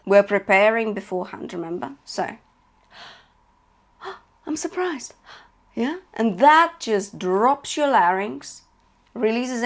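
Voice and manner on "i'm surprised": quick startled intake of breath, then a soft line; surprised gasp. Shown as a demonstration. Coach demonstrating the surprise breath, gasping softly. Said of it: "Take in a breath as if you're surprised".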